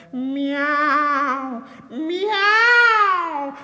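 piercing, very high long meows; cats crying out. A narrator's reading. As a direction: cry out like a chorus of cats. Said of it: "they all cried out together".

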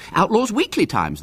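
lightly voiced high-pitched boy; a hint of an eager boy; boy's suggestion. A narrator's reading. Narrator voices Ginger, a boy.

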